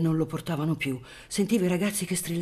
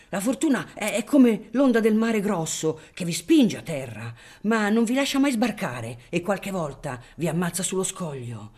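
put-on a father hushing his kids, raised voice; scolding father; 4 semitones higher, 5 dB louder than usual. Father scolding his boys in the crowd, a touch louder. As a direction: scold the children while holding back hope. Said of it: "sgridando i figliuoli".

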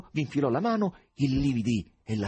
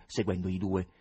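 plain reading voice

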